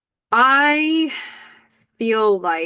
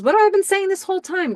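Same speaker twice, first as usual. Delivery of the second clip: high, quick excited outburst; 12 semitones higher, 4 dB louder, faster than usual. Retold or imitated. Karen excitedly pressing Graham about the scene, high excited retelling. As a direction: imitate her bursting with excitement, pitched way up. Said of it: "She's so excited she has to talk to Graham".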